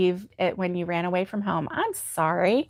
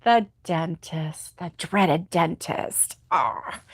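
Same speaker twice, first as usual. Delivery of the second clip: slow, low, softened dread; mock dread; 5 semitones lower, 4 dB quieter, slower than usual. Host commiserating with viewer about dentist, mock dread. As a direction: dwell gloomily on it, slowly sinking.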